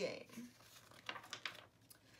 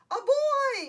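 high, very loud storybook yell; 14 semitones higher, 11 dB louder than usual. A narrator's reading. Bear spotting a boy, yelling. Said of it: "yelled the bear".

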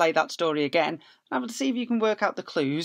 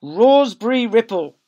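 storybook read; 8 semitones higher, 14 dB louder, slower than usual. Acted. Reading the label aloud.

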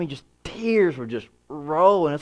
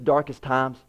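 ordinary talk, nothing marked

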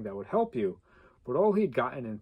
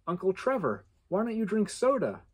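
put-on child's voice; 6 semitones higher than usual. Niece asks uncle a question.

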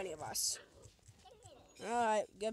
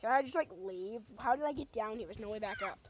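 normal talking voice

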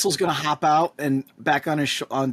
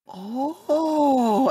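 realization; 9 semitones higher than usual. Acted. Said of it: "Dagny's gonna be like".

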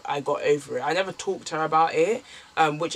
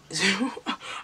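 a short, higher laugh breaking out; real laugh; 4 semitones higher than usual. Real, not acted. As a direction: laugh it off, a little embarrassed. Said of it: "I don't know why I'm laughing now".

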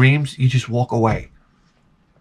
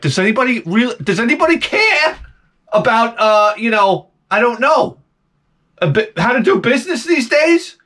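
high, strained comic complaint; exasperated calling out; 9 semitones higher than usual. Acted. Customer calls out for anyone at the bank, exasperated. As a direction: climb high as you demand service.

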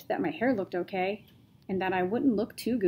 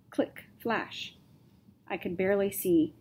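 ordinary delivery, nothing marked

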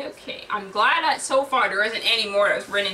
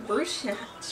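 plain reading voice

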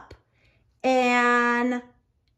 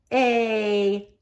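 plain reading voice

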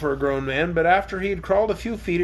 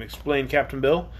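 usual speaking voice

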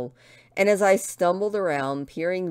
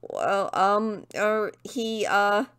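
halting, raised-pitch hesitation; flustered stammer; 5 semitones higher, slower than usual. Acted. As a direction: stall nervously, filler after filler. Flustered before the angry woman.

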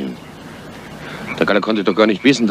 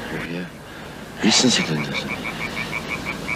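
much softer, very slow wary question; hushed suspicion; 3 semitones lower, 9 dB quieter, much slower than usual. Acted. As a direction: drop the voice, draw it out. Misstrauische Nachfrage, leise. (leise, sehr langsam, misstrauisch)

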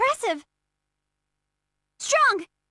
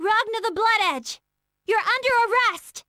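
ordinary talk, nothing marked

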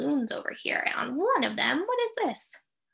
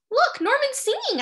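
excited; 11 semitones higher, 5 dB louder than usual. Acted. Excited kid exclaims.

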